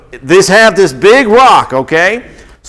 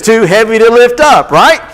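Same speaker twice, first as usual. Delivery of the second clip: laughing; 7 semitones higher, 3 dB louder than usual. Real, not acted. Joking with kids about rocks.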